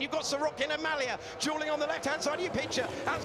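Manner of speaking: high, fast live race call; motorsport commentator. Real, not acted. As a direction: call the battle as it happens.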